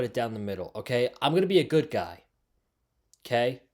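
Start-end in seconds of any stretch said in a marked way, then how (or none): 1.2-2.2 quick, raised, teasing good-guy line; playful. Pretend. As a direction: toss it off brightly and quickly. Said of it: "I'm gonna be a good guy and say".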